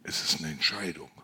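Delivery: ordinary talk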